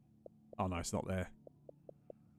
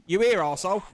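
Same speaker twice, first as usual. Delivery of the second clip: loud, higher call into the empty room; 8 semitones higher, 9 dB louder than usual. Real, not acted. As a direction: shout out to check if he's there. Calling out for a player in-game.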